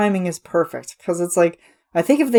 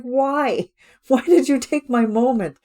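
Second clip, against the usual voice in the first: much higher, petulant whine; mock whiny complaint; 7 semitones higher than usual. Ursula upset at the chef for stealing her moment, mock whine. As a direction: pretend your spotlight was stolen. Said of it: "Like, it's just like".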